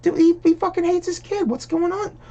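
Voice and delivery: high-pitched disbelief, voice jumping way up; a shrill falsetto of disbelief at the screen; incredulous. Retold or imitated. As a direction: squeak out the shock that he hates his kid. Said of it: "I'm like".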